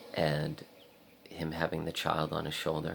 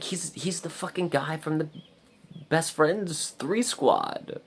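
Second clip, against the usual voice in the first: high, squealing, drawn-out excitement; retold giddy recognition; 11 semitones higher, slower than usual. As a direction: stretch it out high, thrilled.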